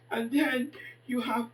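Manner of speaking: ordinary talk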